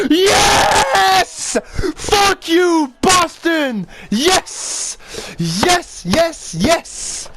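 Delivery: high, wild celebratory yelling; triumphant shouting. Real, not acted. Player scoring against Boston, triumphant shouting. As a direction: scream each yes in triumph. Scores tying goal.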